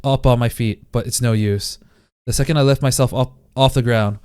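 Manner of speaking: ordinary delivery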